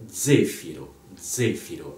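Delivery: ZEfiro, stressed first syllable, Italian vowels; Italian pronunciation. Shown as a demonstration. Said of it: "Pour le prononcer à l'italienne".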